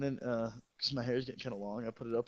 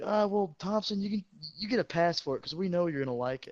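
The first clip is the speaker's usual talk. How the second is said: higher, louder mocking teammate; teammates teasing; 5 semitones higher, 5 dB louder than usual. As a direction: rib him for wanting it. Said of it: "everybody's like".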